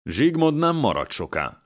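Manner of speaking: mild menacing hiss, starting high; a narrator's hint of a hissing prince; hissed menace. A narrator's reading. Prince threatening a guest of the house, hissing. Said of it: "sziszegte a herceg".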